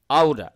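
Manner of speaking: "out of" said as "auda"; American flap. Shown as a demonstration. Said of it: "আমেরিকানরা বলে আউডা". ("out of" American উচ্চারণে আউডা)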